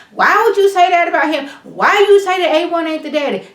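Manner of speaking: a hint of an indignant demand; mock confrontation. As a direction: replay the accusation with heat. Retold angry confrontation.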